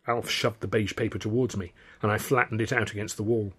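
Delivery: plain reading voice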